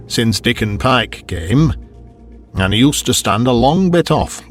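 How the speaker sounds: ordinary delivery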